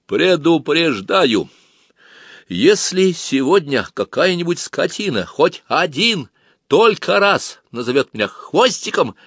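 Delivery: high, slow, every word rapped out hotly; heated and emphatic. Acted. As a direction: hammer each word home as a warning. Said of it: "он запалом отчеканил".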